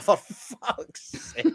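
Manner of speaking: high, slow laughing groan of words; exasperated. Retold or imitated. Customer told none in stock, high drawn-out exasperation. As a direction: draw out the exasperation, half laughing. Exasperated reaction to shopkeeper's refusal.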